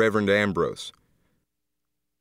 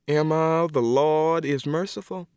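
comforting; 6 semitones higher than usual. Acted. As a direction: soothe a grieving friend. Aunt comforting Emma.

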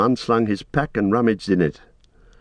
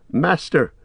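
high-pitched call for his master; raised, urgent call; Sam calling out; 7 semitones higher, slower than usual. A narrator's reading. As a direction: call anxiously down to someone out of sight. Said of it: "he called".

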